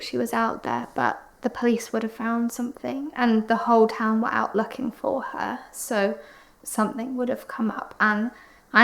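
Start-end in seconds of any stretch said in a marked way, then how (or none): none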